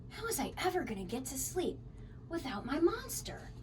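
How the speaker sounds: ordinary delivery, nothing marked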